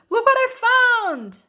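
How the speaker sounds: high, loud, drawn-out call; excited shout. A narrator's reading. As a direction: shout out a proud discovery. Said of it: "return at the end of the day shouting".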